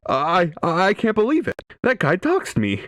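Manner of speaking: high-pitched mock disbelief; shocked Sonic. Reviewer voices Sonic after being doxed, mock shocked.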